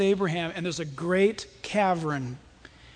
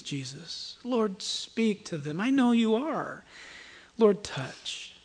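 fervent prayer; 3 semitones higher than usual. Real, not acted. Preacher praying to the Lord for the congregation, fervently. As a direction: pray earnestly, pausing between phrases.

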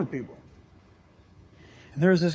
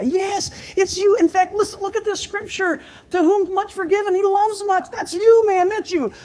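much higher, urgent pleading voice; earnest pleading; 12 semitones higher, 4 dB louder than usual. Retold or imitated. Preacher pleading with the despairing biker, high urgent plea. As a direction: beg him to believe he's forgiven.

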